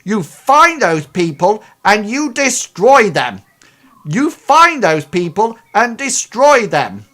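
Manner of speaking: furious barked order, high and loud; irate boss yelling at the team; shouting. Acted. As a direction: roar the order at them. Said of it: "he says / almost, like, shouting at you guys".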